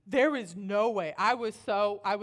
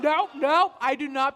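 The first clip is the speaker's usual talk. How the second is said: loud, raised-pitch no; emphatic refusal; 5 semitones higher, 11 dB louder than usual. Retold or imitated. Babysitter stopping a child calling emergency, emphatic refusal. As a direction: shut the idea down loudly.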